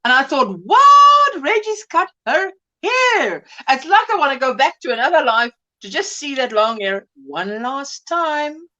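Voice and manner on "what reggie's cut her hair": shocked high cry, slow after; surprised. Retold or imitated. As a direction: gasp it out in disbelief. Shocked at Reggie's haircut.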